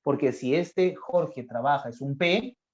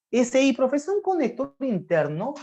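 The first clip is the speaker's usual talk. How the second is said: lightly voiced student, higher; a hint of a pupil, pitched up; imagined student's objection; 7 semitones higher than usual. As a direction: pipe up to the teacher with a doubt.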